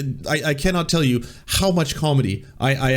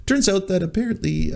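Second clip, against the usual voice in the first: ordinary delivery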